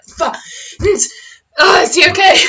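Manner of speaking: high, loud pained cry; pained shout. Acted. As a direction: cry out weakly hurt. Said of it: "he's just like".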